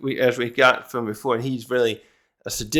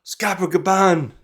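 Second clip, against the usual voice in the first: higher, slower exclamation in shock; shocked cry; 5 semitones higher, slower than usual. Retold or imitated. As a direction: gasp out the name in total shock. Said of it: "the look on Summers' face is like".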